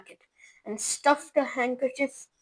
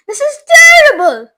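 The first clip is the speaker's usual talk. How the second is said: high, loud despairing wail; 13 semitones higher, 17 dB louder than usual. A narrator's reading. Mother wails over her shrunken son. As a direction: lament it. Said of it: "wailed Missus T V".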